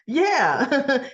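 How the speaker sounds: mock giggle around the word; put-on chuckly laugh; imitated giggling. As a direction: giggle as if sharing a joke, acting it out. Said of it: "and she's giggling".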